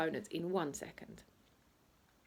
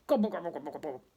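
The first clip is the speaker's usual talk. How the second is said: greedy gobble noises, fading on the last; loud gobbling; 2 semitones lower, 4 dB louder, much faster than usual. Acted. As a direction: gulp it down noisily, then trail off. Gobbling sound of eating.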